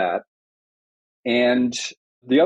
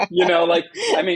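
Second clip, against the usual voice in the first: laughing; 12 semitones higher than usual. Real, not acted. Laughing at own joke.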